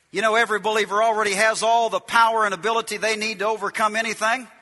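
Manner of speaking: ordinary talk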